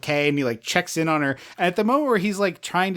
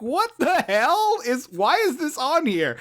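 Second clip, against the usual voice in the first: incredulous; 8 semitones higher than usual. Retold or imitated. Podcaster reacting to a horror theme on the CD, incredulous. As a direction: pitch it way up in mock disbelief. Own reaction to the odd track.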